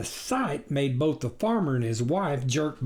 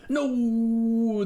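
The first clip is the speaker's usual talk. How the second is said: howled; 8 semitones higher than usual. Acted. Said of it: "the shag-covered stranger howled".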